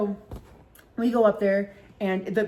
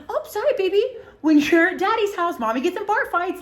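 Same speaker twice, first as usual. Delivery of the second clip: much higher, sing-song fake-sweet mom voice; mock sweet mommy; 10 semitones higher, 4 dB louder than usual. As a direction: play the guilty mom cooing to her kid.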